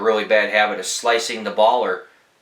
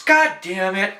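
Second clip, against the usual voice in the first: mock exasperated groan; 9 semitones higher than usual. Golfer sees the ball at his feet.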